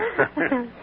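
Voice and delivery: high burst of laughter; hearty laugh. Acted. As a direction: laugh at her teasing. Uncle laughs at niece.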